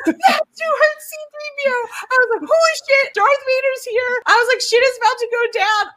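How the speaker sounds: shocked fan losing it; freaked-out reenactment. Retold or imitated. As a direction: relive the shock, voice way up. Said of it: "I was flipping out".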